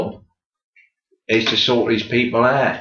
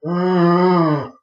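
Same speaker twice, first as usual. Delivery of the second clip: high groaning arr; a moaning arr; corpse moan; 6 semitones higher than usual. Retold or imitated. Undertaker imitating a dead body's groan. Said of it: "And they went".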